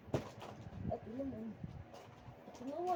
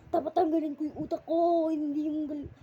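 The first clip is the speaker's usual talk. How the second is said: loud, higher pretend rage; mock angry shout; 5 semitones higher, 10 dB louder than usual. Kid playing angry on his gaming channel, loud mock shout. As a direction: yell it like a hammed-up tantrum. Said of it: "galit na naman ako".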